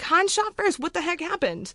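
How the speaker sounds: high-pitched, baffled outburst; incredulous protest. Retold or imitated. Retells disbelief at the film change.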